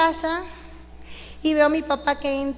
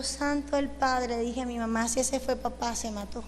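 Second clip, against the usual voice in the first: dropped to a much softer, stunned retelling; hushed shock; 3 semitones lower, 7 dB quieter than usual. Retold or imitated. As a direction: say it softly, as if it's still hard to believe. Retelling the father's fall, shocked.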